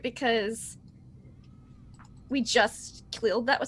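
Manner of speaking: normal talking voice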